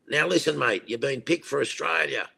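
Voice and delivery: lightly voiced Aussie caller breaking the news. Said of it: "there's this Aussie voice on it going".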